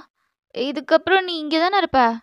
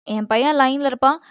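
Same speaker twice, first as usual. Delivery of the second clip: ordinary delivery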